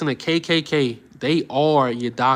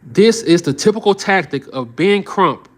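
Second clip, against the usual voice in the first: normal talking voice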